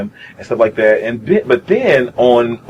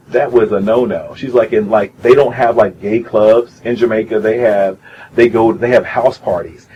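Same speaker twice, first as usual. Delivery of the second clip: ordinary talk, nothing marked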